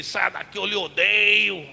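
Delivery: slow, raspy demon voice; possessed man hissing. Retold or imitated. Preacher voicing the possessed man rejecting the teacher. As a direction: spit out the hate slowly. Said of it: "E ele".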